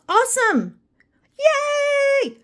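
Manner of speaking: high squeaky little cheer; mock cheer. Praising viewers who remember, high mock cheer.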